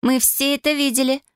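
put-on a cheerful young cousin agreeing; young girl voice. A narrator's reading. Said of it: "закивала Аня".

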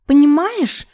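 high shrill shriek; shrieking Irka. A narrator's reading. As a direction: squeal it out high and loud. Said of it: "верещала Ирка". (визгливый крик)

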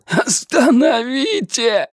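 high, cracking desperate cry; breaking voice scream. Acted. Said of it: "срывающимся голосом закричал он". (срывающийся крик)

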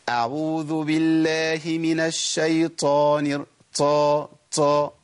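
slow recitation ending in طا طا with air escaping on the ط; recite, then puff out طا طا with breath; breathy taa mistake. Retold or imitated. Teacher imitating a student who lets breath through the ط. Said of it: "بيقول إيه".

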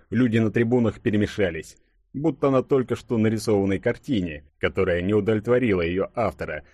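plain reading voice

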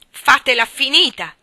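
sudden, very loud angry outburst; brusque yell. A narrator's reading. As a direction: cut her off with a high, forceful shout. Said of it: "le urlai bruscamente". (urlo brusco)

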